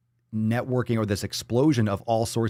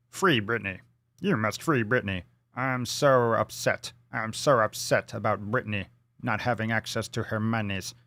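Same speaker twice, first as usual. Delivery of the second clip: hammed-up upset in a put-on accent; mock upset protester. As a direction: imitate an aggrieved fan, every word laboured. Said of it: "he's so upset about [name]'s conservatorship. He's like".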